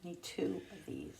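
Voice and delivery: whispered, no voice. Real, not acted. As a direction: breathe it as a hushed aside. Repeats it quietly to child.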